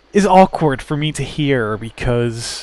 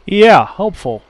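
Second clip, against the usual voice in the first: louder, higher mock praise; sarcastic; 4 semitones higher, 6 dB louder than usual. Real, not acted. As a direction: drip sarcasm at the useless answer. Mocking unhelpful game character.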